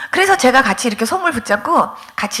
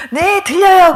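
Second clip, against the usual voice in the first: higher, louder, ready yes; welcoming assent; 7 semitones higher, 5 dB louder, slower than usual. Retold or imitated. Church member welcoming a caller to drop by, eager assent. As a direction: imitate someone gladly agreeing on the phone.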